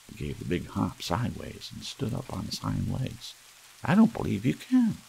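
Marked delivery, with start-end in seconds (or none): 3.8-5.0 high, loud teasing doubt; doubting challenge. A narrator's reading. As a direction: dare him, much higher and louder. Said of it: "he said".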